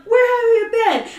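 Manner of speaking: high, loud, hammed-up demand; mock scolding. Relatives scold the found child.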